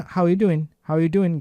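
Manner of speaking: "How you doing?" with "are" gone and "doing" ending in "doin'"; quick, casual "How you doin'?"; dropped G. Shown as a demonstration. Teacher showing how film characters say it, dropped-G "How you doing?". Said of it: "Ini G-nya juga hilang".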